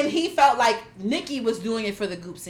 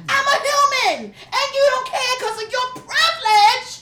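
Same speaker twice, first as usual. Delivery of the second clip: much higher mocking impression of her outrage; mock rant; 11 semitones higher than usual. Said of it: "she was like".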